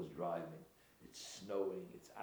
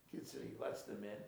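normal talking voice

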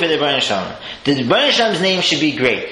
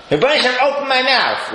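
higher, lifted plea to God; raised prayer line; 9 semitones higher than usual. Shown as a demonstration. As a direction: lift the words up as a prayer. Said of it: "I say".

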